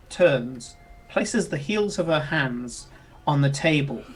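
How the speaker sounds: usual speaking voice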